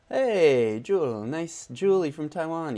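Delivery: loud, high, bright greeting to a viewer; loud cheery streamer shout-out; excited shout-out. Real, not acted. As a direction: call out the name like spotting a friend. Greeting chat viewer by name.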